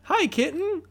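very high squeaky voice; squeaky cartoon voice. Silly high character voice.

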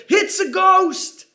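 high, slow frightened cry of a ghost sighting; terrified cry. A narrator's reading. Disciples in the boat crying out at a figure on the water, terrified. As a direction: cry out in fear as if seeing a ghost. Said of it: "they cried out with fear".

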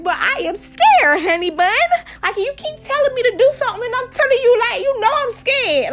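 high, frantic mock protest; playful panicky whine; hammed-up scared plea. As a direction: plead with a pushy friend, voice shooting up. Acted frantic plea to friend.

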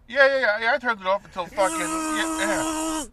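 high, loud careless yes-man; dopey worker reply. Retold or imitated. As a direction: answer like a clueless coworker. Voicing careless worker answering.

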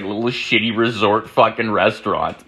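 normal talking voice

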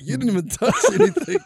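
teasing the cousin; incredulous. Retold or imitated. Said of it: "looking at you like".

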